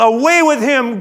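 loud, high, drawn-out demand; crowd yell. Acted. The crowd demanding Jesus be taken away, drawn-out yell. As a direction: yell it out as the mob. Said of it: "they yelled".

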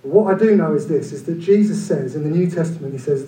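ordinary delivery

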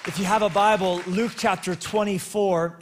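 loud, rising sermon announcement; preaching at full force. Acted. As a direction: proclaim it to the whole hall. Preacher raises voice announcing title.

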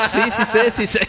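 mock singsong insistence. Co-host teasing her partner, bouncy mocking insistence. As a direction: tease with a bouncy "sí sé".

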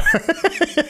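short high-pitched chuckle; real amused giggle; high laugh. Real, not acted. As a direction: break into a light laugh. Laughs while googling.

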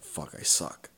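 breathy whispered self-putdown; a retold whisper of giving up; whispered despair. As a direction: mutter it under your breath, defeated. Retold despairing thought at convention.

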